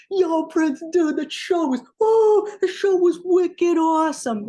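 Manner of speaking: loud, high, rowdy Boston shout; thick Boston accent. Retold or imitated. Boston kid barging in on [name] backstage, thick excited Boston accent. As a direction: whoop it up like a wasted Boston street kid. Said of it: "with his thick Boston accent".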